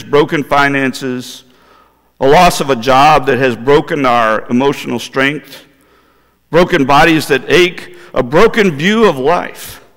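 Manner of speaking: ordinary talk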